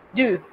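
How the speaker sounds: "du" con una u francesa cerrada, labios hacia adelante; French du. Shown as a demonstration. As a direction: di "du" con la boca casi cerrada y los labios en punta. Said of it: "Esa es una I bien cerrada". ("du" con u cerrada y labios redondeados)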